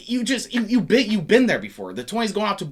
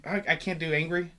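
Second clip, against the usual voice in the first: usual speaking voice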